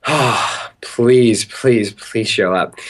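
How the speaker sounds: slow, sighed plea under the breath; retold desperate wishing; acted pleading. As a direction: beg with a big exhale, each please drawn out. Acts out his anxious wait for Branson.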